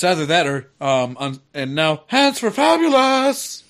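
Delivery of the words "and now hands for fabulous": flamboyant announcer. Acted. Announces episode title grandly.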